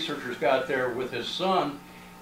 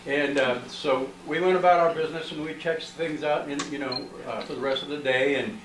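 ordinary talk

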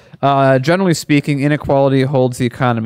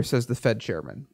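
usual speaking voice

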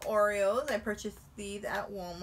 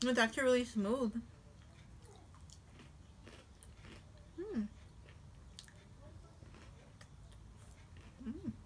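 words between cookie bites; talking while chewing; 4 semitones higher, 6 dB quieter than usual. Real, not acted. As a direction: mouth partly full. Seen 0: Bites cookie pieces and chews visibly while talking between bites.

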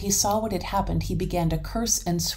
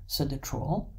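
plain reading voice

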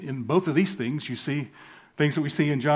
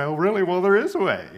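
mock schoolteacher comeback, higher and louder; English teacher's correction; 7 semitones higher, 8 dB louder, faster than usual. Eighth grade English teacher correcting his double negative, mock-pointed. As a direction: imitate a teacher pointedly correcting a pupil, pitched up. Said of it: "And she'd say".

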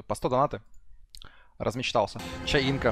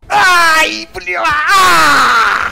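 screaming; 13 semitones higher, 12 dB louder, much slower than usual. Real, not acted. Screams at game.

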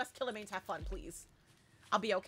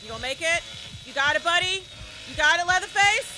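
louder and higher with each question, building to a shout; teasing encouragement; 5 semitones higher, 7 dB louder than usual. Real, not acted. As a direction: call out to a struggling buddy, rising at the end. Cheering on struggling Leatherface.

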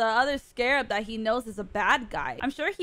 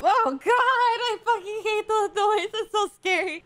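high, startled cry of dread; high-pitched creeped-out exclamation; scared outburst; 7 semitones higher, 3 dB louder than usual. Real, not acted. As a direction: recoil in fright, pitch jumping up. Startled by scary noise in show.

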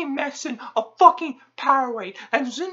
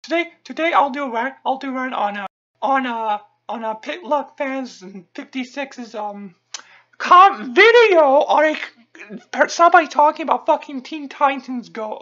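ordinary delivery

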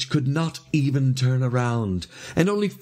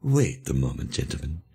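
low, restrained leader's voice; calm the men in a low voice; low, controlled interjection; 11 semitones lower than usual. A narrator's reading. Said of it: "said the leader".

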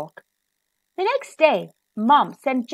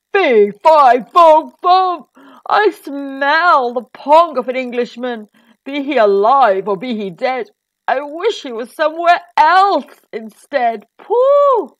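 big, loud storybook giant; roaring giant; 2 semitones higher, 9 dB louder than usual. A narrator's reading. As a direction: roar it out like a hungry giant. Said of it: "roared the giant".

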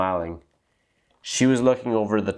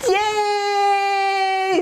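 put-on kids' voices cheering; excited kids cheering. A narrator's reading. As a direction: shout it with joy like a class of kids. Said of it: "said all the kids".

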